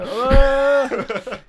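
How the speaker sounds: long high, loud mock scream. Friend joins in panic at a falling giant, mock scream. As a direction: let out a drawn-out comic scream.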